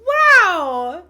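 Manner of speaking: real delighted wow; amazed wow. Real, not acted. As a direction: gasp it out in delight. Moved by the card message.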